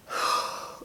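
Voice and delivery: a long, heavy exhale as if winded; heavy breathless sigh. Pretend. Pregnant mom showing how winded she gets, heavy sigh. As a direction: let out a big tired breath. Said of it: "And I will just go".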